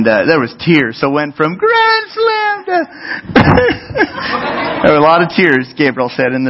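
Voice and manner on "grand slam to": mock wail. Crying kid, mock wail.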